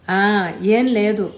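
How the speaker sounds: slow, hesitant stumble; fumbling for an answer; faltering. Acted. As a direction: caught off guard, stalling. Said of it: "అంటూ తడబడ్డాడు".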